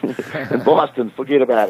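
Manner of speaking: laughing mock Boston accent, slow and high. Teasing the crew about Boston, in a slow, high mock Boston accent. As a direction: ham up the Boston forget about it. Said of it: "watch out with those accents".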